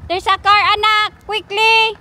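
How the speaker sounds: loud, high warning shout; real alarm, shouting a warning; urgent shout. Real, not acted. Urgent warning about a car.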